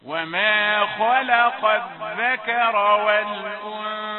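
slow, drawn-out chanted recitation; Quran recitation. Shown as a demonstration. Teacher reciting verses to his students in slow tajweed chant. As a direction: intone the verse slowly and loudly.

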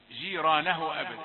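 ordinary talk

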